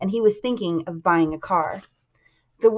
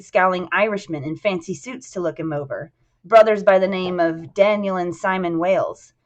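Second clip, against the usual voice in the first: plain reading voice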